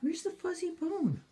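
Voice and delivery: talking to a dog. Real, not acted. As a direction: excite the dog. High excited dog talk.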